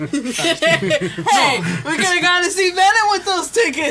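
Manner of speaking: loud, high, laughing it out; laughing protest. Real, not acted. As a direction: shout it out through laughter.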